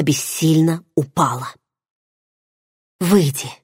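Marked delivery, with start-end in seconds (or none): 3.0-3.5 hopeless plea. A narrator's reading. Heroine hopelessly repeating her request for Varan to leave. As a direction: give up as you ask again. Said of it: "безнадёжно повторила свою просьбу я".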